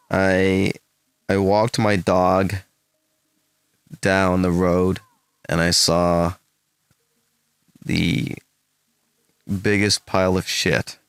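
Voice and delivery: attempted American accent, slow and halting; a careful American accent, phrase by phrase. Shown as a demonstration. Streamer trying an American accent for a viewer, halting American accent. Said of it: "can you do your best American accent".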